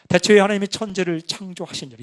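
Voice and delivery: loud, measured Bible reading; scripture reading demo. Shown as a demonstration. Pastor demonstrates his recorded Bible reading voice, solemn. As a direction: read Genesis as on the recording. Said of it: "이런 속도로 이제 읽는 거죠".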